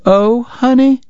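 doting wife greets the ragged traveler, high and fussing; fussing Southern lady. Acted. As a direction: coo over the sick boy in a high voice. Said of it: "she cried".

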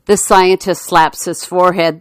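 ordinary delivery

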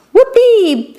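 gleeful squeaky cheer, pitch way up; high storybook whoop; high squeak. A narrator's reading. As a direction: squeak it out in delight. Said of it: "Pip squeaked".

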